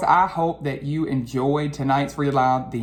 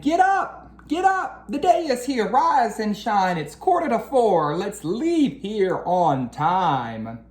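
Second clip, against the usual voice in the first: high excited wake-up call, much higher; Dad shouting; 7 semitones higher than usual. Acted. Teacher voicing Dad waking the kids, high excited call. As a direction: rouse the family before dawn. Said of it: "Dad shouted".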